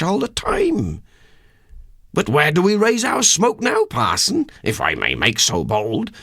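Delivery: a humble haggler puffing up at his noble blood; rustic countryman musing. Acted. Durbeyfield asks the parson where his family lives, rustic and wondering.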